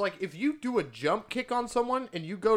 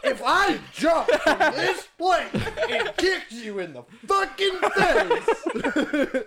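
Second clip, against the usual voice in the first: excited laughing; 7 semitones higher, slower than usual. Real, not acted. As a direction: laugh through an excited rant. Excited jump kick argument.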